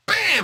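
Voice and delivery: high, loud shouted bam; punch sound. Retold or imitated. Narrator punches up the fight with a high, loud bam. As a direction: slam it out like a comic panel.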